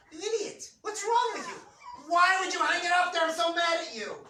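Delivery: high, loud scolding yell; mock-furious wife yelling at her husband. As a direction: scream at him like a furious wife. Wife scolding husband over picture.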